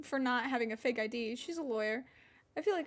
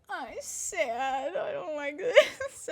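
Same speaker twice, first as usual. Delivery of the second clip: slow whimper swelling louder; mock drugged whine; 2 semitones higher, 4 dB louder, much slower than usual. Drugged teen on the couch with mom, mock-tearful whine. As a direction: whine it, stretching the end. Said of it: "I'm still like".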